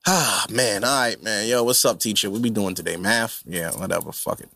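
slumped student half-listening to the teacher; bored kid. Retold or imitated. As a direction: drag through it like a kid who can't be bothered. Kid lazily attending online class.

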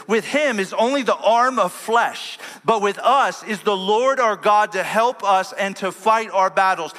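high, loud, slow and ringing sermon voice; preaching at full force. Acted. Pastor booming Hezekiah's words to his army, preaching at full force. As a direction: thunder it like a preacher rallying troops.

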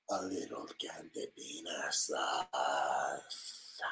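close-mic murmur. Acted. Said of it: "that's why Tim Dillon talks like that".

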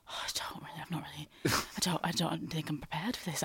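unvoiced, panicked whisper; flustered whisper. Cornered by a journalist's question, whispering. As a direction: whisper it like you're caught out. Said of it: "I was like".

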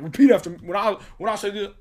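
loud, high-pitched mock motivator; mock Jason Brown. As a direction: imitate a coach demanding a chant back. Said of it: "And then he's like".

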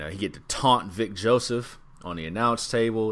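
ordinary talk, nothing marked

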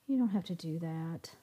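a gloomy, defeated reply. A narrator's reading. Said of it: "replied Zach gloomily".

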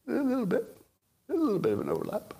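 high soft mocking understatement; tiny teasing "a little bit"; sarcastic, high and soft.

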